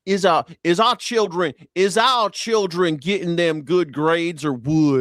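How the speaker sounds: high, slow mock drawl of a politician; imitating a politician's garbled grammar; lightly hammed-up politician; Mark Wayne Mullen. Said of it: "Have you heard Mark Wayne Mullen talk".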